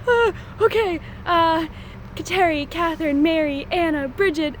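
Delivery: mock flustered brainstorm. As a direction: blurt names out in a panic. Flustered rapid listing of names.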